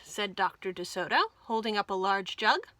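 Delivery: usual speaking voice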